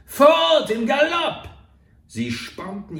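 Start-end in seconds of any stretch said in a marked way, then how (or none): 0.1-1.5 very high and loud, drawn out; panicked shout. A narrator's reading. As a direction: yell it to spur the escape. Fleeing, urging partner on.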